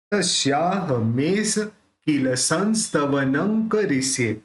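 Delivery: drawn-out syllables for learners; very slow recitation. Shown as a demonstration. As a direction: sound out संस्तवनं with both dots as न, very slowly. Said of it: "दोनों बिंदी का उच्चारण न ही होने वाला है".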